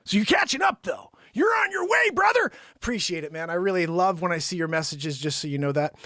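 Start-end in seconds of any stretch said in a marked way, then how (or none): 0.0-2.5 high, loud cheering; excited cheer. Real, not acted. Host cheering a loyal viewer on, high and loud. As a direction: cheer him on like a coach.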